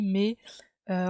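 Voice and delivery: usual speaking voice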